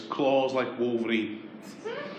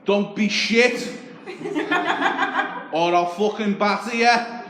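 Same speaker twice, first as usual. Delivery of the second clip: high and loud Scouse yell out the window; shouting taxi driver; 8 semitones higher, 6 dB louder than usual. Retold or imitated. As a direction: call out from the cab across the street.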